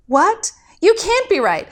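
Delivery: high, shocked what; disbelief. Acted. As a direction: reel at the news. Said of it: "Zechariah disbelieving".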